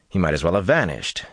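plain reading voice

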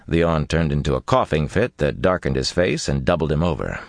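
plain reading voice

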